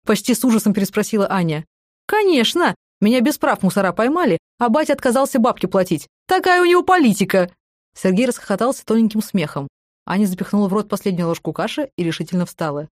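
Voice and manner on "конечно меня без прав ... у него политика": gleeful. A narrator's reading. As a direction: brag with glee. Sergey boasting gleefully.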